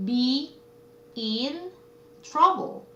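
"Be in trouble" with a clear pause after each word. Shown as a demonstration. Teacher presenting a phrase to students, "Be in trouble" word by word. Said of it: "어려움에 처하다".

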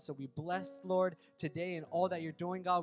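soft, hushed prayer voice over music; a hushed, intimate opening prayer. Real, not acted. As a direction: pray quietly and reverently, eyes closed. Opening prayer before the sermon.

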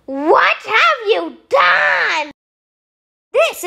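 high, slow anguished cry; slow horrified shout. Acted. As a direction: wail at the ruined page. Gum found on page.

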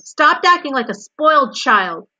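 high, loud rebuke; screamed scolding. A narrator's reading. Wilbur screams at the lazy, grinning rat. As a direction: scold at a yell. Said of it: "screamed Wilbur".

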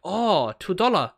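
drawn-out mock Chinese-English echo; mimicked Chinese accent. Customer echoing the shopkeeper's price, mimicking a Chinese accent. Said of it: "really racist when you go into".

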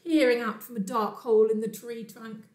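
ordinary delivery, nothing marked

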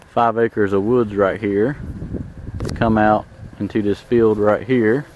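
ordinary talk, nothing marked

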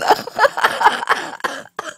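high, long wheezing laugh; helpless laughter. Real, not acted. Singer cracks up at their failed tenor, helpless laughter. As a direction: fall apart laughing.

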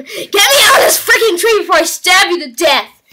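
high, loud frantic scream; hammed-up furious kite yelling threats; yelled back. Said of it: "The kite yelled back to him".

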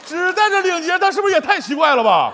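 high-pitched comic disbelief; high, exasperated complaint; incredulous protest. Acted. Protests partner's absurd detail.

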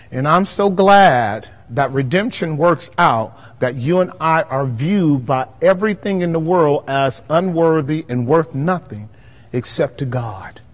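ordinary talk, nothing marked

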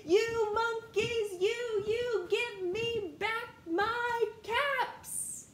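high, loud, slow shouting at the monkeys; angry peddler yell. Acted. Peddler shouting up at the monkeys, high and angry. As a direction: shake your fist and yell up the tree.

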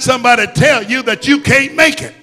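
loud, driving sermon voice; full-force preaching. Acted. Preacher rallying the congregation, preaching full force. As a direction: preach it hard over the music.

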